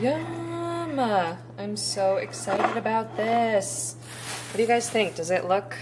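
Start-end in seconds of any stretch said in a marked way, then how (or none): 0.0-1.0 excited. Real, not acted. Excited about the finished chicken. 1.0-4.0 slow, stretched, thrilled; drawn-out excitement. Real, not acted. Excited about the finished chicken.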